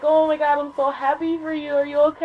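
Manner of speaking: high, fussing friend voice; imitated excited well-wisher; happy concern.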